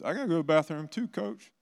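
put-on high, fast pleading kid voice, rising at the end; whiny kid. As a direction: mimic a kid begging to leave class. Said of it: "And then a couple other kids".

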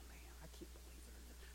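fast, soft disbelieving whisper; whispered thought. Retold or imitated. Pastor voicing his private judgment, fast whisper. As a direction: mutter it under your breath.

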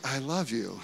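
a hushed, low "I love you"; soft I love you. Shown as a demonstration. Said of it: "There's a complete different way that I'm saying that".

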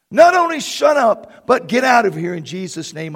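preacher shouting the demon away; shouted command. Retold or imitated. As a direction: bark the demon out the door. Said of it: "I commanded".

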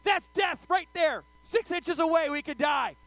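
high, loud terrified yelling on a ride; frantic high shout of roller-coaster fear; panicked screaming. Retold or imitated. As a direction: scream it at your seatmate like you're about to drop. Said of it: "screaming at Jasmine".

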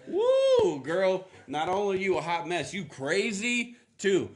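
loud, high, sassy; sassy disbelief. Retold or imitated. Preacher playing Joseph mocking Mary's claim, loud and sassy. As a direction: roast her in disbelief.

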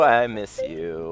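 "I miss you" dropped low, soft and stretched out slowly; mock longing. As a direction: croon it low and slow like mock heartache. Greeting Machete again, drawn out.

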